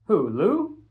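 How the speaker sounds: a different voice checking who; lifted, quick who; voiced Coach. Coach asks which boy.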